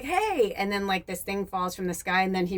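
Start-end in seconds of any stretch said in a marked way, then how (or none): 0.0-0.5 sudden high startled yelp; startled hey. Retold or imitated. Man shoved on the street, high startled yelp. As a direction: jump as if shoved aside. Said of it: "the guy's like".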